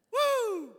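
loud, high exultant woo; sudden high holler; preacher's whoop. Real, not acted. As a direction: let out a piercing whoop. Preacher whoops after mock amazement.

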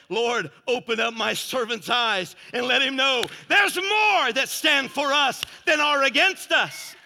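loud, emphatic sermon delivery; full-force preaching. Real, not acted. Preacher declaring God defends Israel to the congregation, full-force preaching.

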